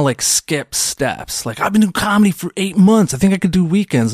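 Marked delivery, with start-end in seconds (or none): 1.6-4.1 higher, mock naive newbie boast; eager newbie comic. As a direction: play the overeager rookie comic, pitched up. Impatient newer comic quoted.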